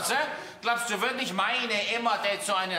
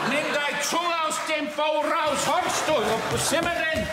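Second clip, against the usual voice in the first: angry shouting; 7 semitones higher than usual. Acted. Father throws out the suitor.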